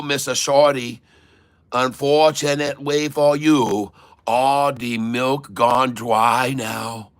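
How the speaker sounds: taunting in a put-on Chinese accent; mock Chinese accent. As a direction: stretch the words in a broad fake Chinese accent. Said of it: "make a Chinese man cry".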